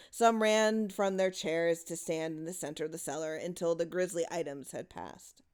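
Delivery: usual speaking voice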